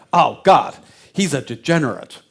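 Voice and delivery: loud, disgusted "he's a degenerate"; disgusted outburst. Retold or imitated. Henry Ford at the Bond photo, loud disgust. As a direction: spit it out in outrage. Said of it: "Henry Ford looks at them and say".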